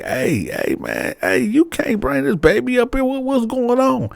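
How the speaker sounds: startled manager. Retold or imitated. Manager sees baby at work.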